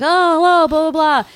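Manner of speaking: high, loud mock shouting into a phone; mock phone call. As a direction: imitate yelling down a field telephone. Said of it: "Tom Hanks is with the telephone guy".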